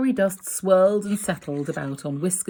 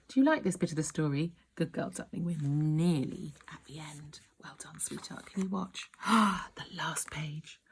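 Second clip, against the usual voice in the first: soft reassuring whisper; 6 dB quieter than usual. Real, not acted. Parent praising the child near the story's end, whispering. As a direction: murmur encouragement softly. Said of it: "soothing asides to child".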